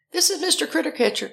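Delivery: high-pitched singsong answering-machine voice; recorded greeting. Retold or imitated. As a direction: mimic a chirpy voicemail greeting. Mimics repeated answering-machine greeting.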